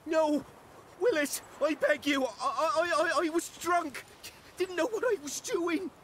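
frantic high-pitched excuse-making; panicked pleading. Acted. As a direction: beg desperately for your life. Arsonist begs for his life.

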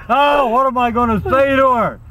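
high-pitched comic fretting; mock panic. Husband panics as wife approaches.

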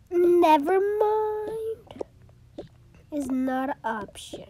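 high, loud, slow Never mind; loud stern scolding. Pretend. Mom scolds.

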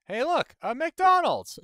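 very high, loud gleeful cry; mock excitement. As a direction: squeal out an exaggerated discovery. Spots a McDonald's, exclaims in mock delight.

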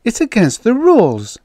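acted shocked voice. A narrator's reading. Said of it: "said in a shocked voice".

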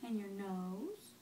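put-on quiet, gentle questions to a baby; soft parent voice. Parent quietly quizzes the baby on body parts and objects. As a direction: ask softly, near a whisper.

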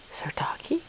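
breathy hushed question, leaning in; whisper. Real, not acted. Owner whispers to her dog about a doggy outside, hushed. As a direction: ask it as a secret.

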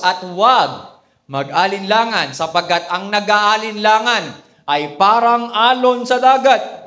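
loud, slow, solemn Bible reading; scripture reading. Acted. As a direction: proclaim the verse to the congregation. Reads scripture aloud. (malakas at mabagal na pagbasa ng Bibliya)

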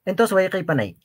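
ordinary talk

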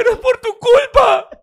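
high, loud, wailing "pero es por tu culpa"; mock crying woman. Man mimics a crying woman blaming her partner. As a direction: play the weeping girlfriend blaming him. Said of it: "y decir".

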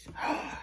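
short breathy gasp of delight. Acted. Reader reacting to the pile of cranberries, delighted gasp. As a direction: gasp as if spotting a treat.

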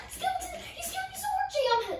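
high panicked yelling; frightened shriek. Real, not acted. Scared by skeleton.

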